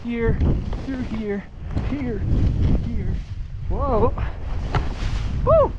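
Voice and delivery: skiing downhill. Real, not acted. Calling out while skiing trees.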